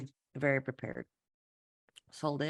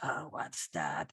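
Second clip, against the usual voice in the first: soft, slow, baffled little question; quiet puzzled aside; 9 dB quieter, slower than usual. Retold or imitated. Interpreter mimicking a confused listener, soft and slow. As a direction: mutter it, lost in the jargon. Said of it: "And you're like".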